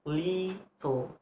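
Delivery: "little" drawn out as LI, pause, tul; slow syllable-by-syllable "little", first part held; split into syllables. Shown as a demonstration. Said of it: "have two syllable".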